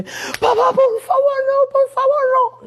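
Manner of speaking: put-on high-pitched pleading child voice; begging child. Father retelling his son's high, tearful plea. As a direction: imitate a kneeling son begging his father. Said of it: "mi hijo mayor se arrodilla y me dice".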